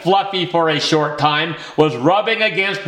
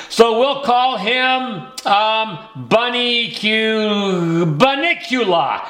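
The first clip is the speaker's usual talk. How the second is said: slow, teasing reveal of the name; drawn-out announcement; 4 semitones higher, slower than usual. Acted. Mom revealing the bunny's name to the boys, slow and drawn out. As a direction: stretch it out before the big reveal.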